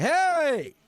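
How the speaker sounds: high, loud hammed-up holler; yelling at intruder. Homeowner to a hobo in the yard, mock angry yell. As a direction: chase someone off with a shout. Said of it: "Pretend like your, y-, your, your yard has".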